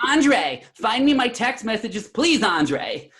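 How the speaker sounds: high, loud, hammed-up pleading; mock screaming. Clowning for the store staff at the clerk's expense, mock screaming. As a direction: beg the clerk in a put-on frantic voice. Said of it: "I started screaming in the store".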